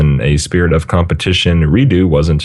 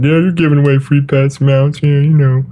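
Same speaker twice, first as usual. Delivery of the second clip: high-pitched imitated whiner; mock complaining; 9 semitones higher than usual. Co-host imitating players who complain, high mock whine. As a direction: mimic a grumbler in a raised voice. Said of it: "People always complained about".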